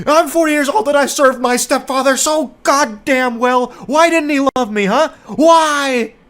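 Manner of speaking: high, loud, hammed-up wailing yell; over-the-top pleading shout; mock anguished outburst. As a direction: yell it like a wounded, unloved stepson. Acting out texter's stepfather outburst.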